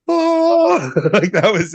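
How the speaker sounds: very high, loud gasping oh; a sudden wide-eyed oh of discovery; stunned realisation. Retold or imitated. New believer after the shroud film, amazed. Coming out of the documentary.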